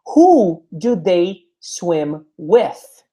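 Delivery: "Who do they swim with?" said at half speed, each word spaced; slow teaching model. Shown as a demonstration. Teacher models the question slowly for the class, drawn out. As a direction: stretch every word out for learners. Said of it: "slow model question".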